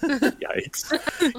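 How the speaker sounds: yikes pushed high and drawn out; mock squeaky yikes. Reacting to the accent joke.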